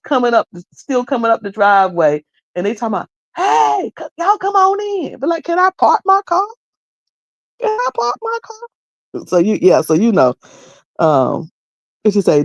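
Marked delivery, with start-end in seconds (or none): 3.4-5.2 high, bright welcome call to folks up the driveway; hostess calling out. Retold or imitated. Host calling guests in from the driveway, high and welcoming. 5.4-9.2 timid guest. Retold or imitated. Arriving guest asking the hostess where to park, high and hesitant.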